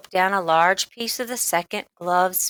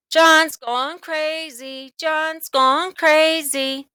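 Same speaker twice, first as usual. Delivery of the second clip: high, slow sing-song yell; yelled taunt; 9 semitones higher, 3 dB louder, much slower than usual. A narrator's reading. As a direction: taunt him loudly, drawn out. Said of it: "Spider yelled".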